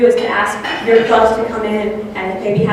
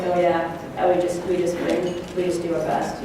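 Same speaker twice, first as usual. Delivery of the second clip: flat, softer, mumbled monotone; 4 semitones lower, 8 dB quieter than usual. Retold or imitated. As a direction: imitate a mellow coach droning evenly. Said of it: "He's just very monotone when he talks".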